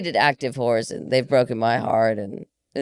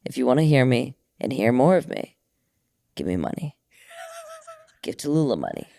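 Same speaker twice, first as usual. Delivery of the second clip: sensual man voice; 4 dB quieter than usual. Pretend. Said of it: "say it in my man voice".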